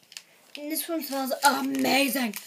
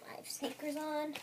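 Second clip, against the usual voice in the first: whisper; 2 semitones higher, 9 dB quieter than usual. Real, not acted. Whispered aside.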